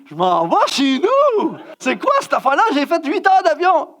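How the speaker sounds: loud-ish, high, drawn-out mock protest; exasperated outburst. Jet-lagged guest wanting to go home, exasperated outburst. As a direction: wail it slowly in comic outrage. Replays first thought as outburst.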